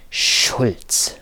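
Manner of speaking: hoarse excited whisper; creaky whisper. A narrator's reading. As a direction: rasp the name under your breath. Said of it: "flüsterte er heiser vor Erregung".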